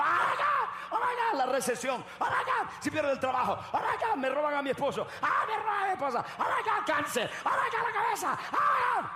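very high, loud mock shrieks of panic; hammed-up coward shrieking in terror; panicked cowardly screaming. Preacher mimicking cowards to the congregation, high panicked screaming. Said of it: "Los cobardes se quedan allá gritando".

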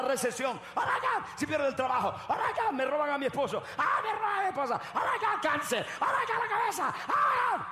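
hammed-up high-pitched hysterical wailing; mock a coward shrieking over every worry; panicked screaming coward. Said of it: "los cobardes se quedan allá gritando".

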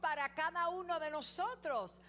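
high, loud, slow climax; impassioned sermon climax; preaching at full force. Real, not acted. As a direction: build to a fervent peak.

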